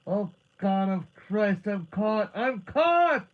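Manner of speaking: panicked full-voiced scream with drawn-out words. A narrator's reading. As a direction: scream in agony for help. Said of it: "screamed again".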